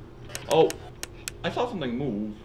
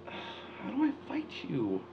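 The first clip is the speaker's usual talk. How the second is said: whisper; 4 semitones higher, 8 dB quieter, slower than usual. Real, not acted. Whispered repeat in tense moment.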